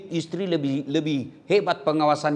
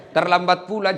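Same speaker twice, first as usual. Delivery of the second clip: ordinary delivery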